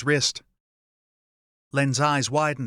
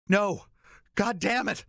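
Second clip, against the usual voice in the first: higher, desperate shout; 7 semitones higher, slower than usual. A narrator's reading. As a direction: yell it in panic. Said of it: "Len shouted".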